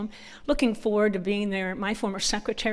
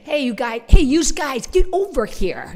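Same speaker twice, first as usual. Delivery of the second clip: loud, high Yankee hoodlum holler; 5 semitones higher, 8 dB louder than usual. Shown as a demonstration. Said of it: "Honestly, we sound like hoods".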